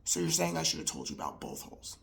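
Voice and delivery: very low and quiet, hurried; meek mumbled reply. Retold or imitated. Husband sheepishly asking his angry wife, barely audible. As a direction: say it tiny and low, under the breath.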